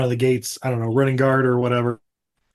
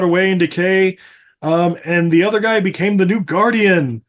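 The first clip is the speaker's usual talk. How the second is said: ancient elder storyteller; 4 semitones higher than usual. Retold or imitated. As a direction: tell the legend like an old being. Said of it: "he's like".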